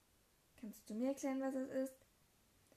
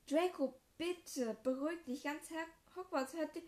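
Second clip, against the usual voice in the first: urgent pleading; 5 semitones higher, 4 dB louder than usual. Acted. Liz pleads with shouting brother.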